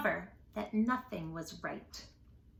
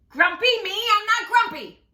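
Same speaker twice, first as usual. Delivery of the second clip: much higher and louder; indignant monkey; 10 semitones higher, 10 dB louder than usual. Acted. Jim denying to Norman, loud high protest. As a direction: protest hard.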